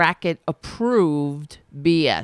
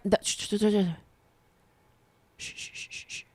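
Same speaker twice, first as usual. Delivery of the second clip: whispered shushes cutting himself off; mock shush; 9 dB quieter, faster than usual. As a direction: hush yourself down mid-word. Shushing cuts him off.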